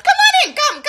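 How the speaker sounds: high, loud imperious welcome; Edna Mode voice. Acted. Edna Mode greets her guest at the door, imperious. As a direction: sweep them in grandly.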